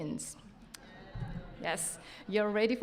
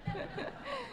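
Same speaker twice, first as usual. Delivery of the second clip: high giggle; 8 dB quieter than usual. Real, not acted. Laughs after kimono joke.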